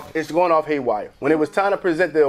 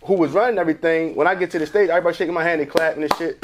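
ordinary talk

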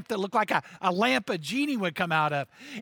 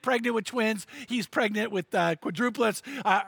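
normal talking voice

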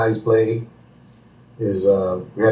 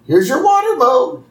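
much higher, sweet Marge voice; 4 dB louder than usual. Retold or imitated. Marge bringing water to Moe, high and sweet. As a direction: hand over the water in a high lilt. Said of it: "And Marge comes in".

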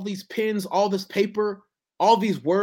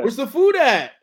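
put-on louder, higher kid asking for food; hungry kid voice; 5 semitones higher, 7 dB louder than usual. Father voicing his son demanding dinner, louder child voice. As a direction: play the hungry little son.

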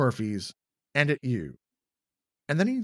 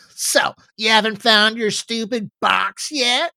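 high, loud teasing jab; taunting; 9 semitones higher, 8 dB louder than usual. Acted. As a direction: needle him with scorn. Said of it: "Jess taunts".

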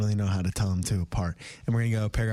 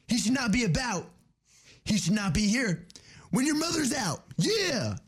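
high, laid-back surfer bro; surfer dude; 13 semitones higher than usual. Acted. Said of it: "next up is a surfer and a skateboarder".

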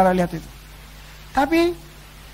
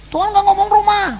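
normal talking voice

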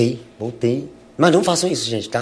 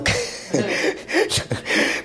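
a soft, airy burst of laughter; breathy laugh; 6 dB quieter than usual. Real, not acted. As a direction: laugh under your breath. Laughs at drug question.

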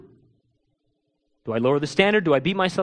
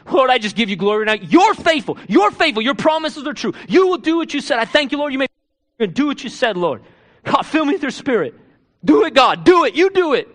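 fast praise rising higher and louder to a cry; fervent prayer building; 5 semitones higher, 4 dB louder than usual. Shown as a demonstration. Pastor demonstrating his prayer to God, building to a loud cry. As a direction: build from quick thanks to a loud plea. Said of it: "So guess what I do?".

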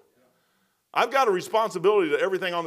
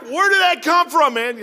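high, loud startled yelp; jolted awake by his wife, blurting it out; 8 semitones higher, 6 dB louder than usual. Retold or imitated. Woken by his wife demanding receipts, startled loud yelp.

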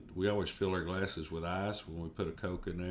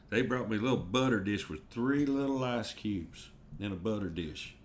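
ordinary delivery

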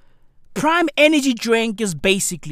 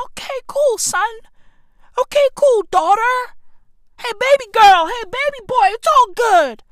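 much higher, louder mock parent cooing at the kids; doting parent; 13 semitones higher, 6 dB louder than usual. Comic mocking spoiling parents answering their kids in a high doting voice. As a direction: ham up an indulgent parent saying yes to everything. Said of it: "Motherfuckers be like".

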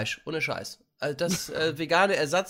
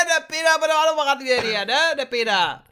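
high brash shout across the kitchen; yelling butcher woman; 11 semitones higher, 4 dB louder, faster than usual. Pretend. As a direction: bellow like a rough butcher lady.

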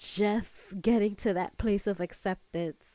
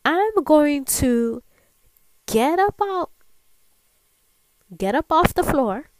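loud, higher, determined declaration; talking back; 5 semitones higher, 8 dB louder than usual. Acted. Woman vowing to her inner voice to get up, loud and resolute. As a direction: push each phrase out hard, with pauses between. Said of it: "talk back to my inner voice".

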